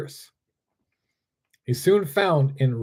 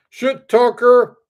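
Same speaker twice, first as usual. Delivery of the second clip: slow, much higher and louder yell; angry yell; 8 semitones higher, 7 dB louder, slower than usual. A narrator's reading. Strongman yelling at the bear before grabbing him, loud slow yell. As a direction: roar the insult at the bear. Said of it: "yelled Bradley".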